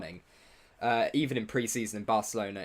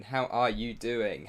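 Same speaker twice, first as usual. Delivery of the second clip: drawn-out, slower repeat of the question; slower than usual. Real, not acted. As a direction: stretch it out the second time. Greeting viewers in chat, repeated.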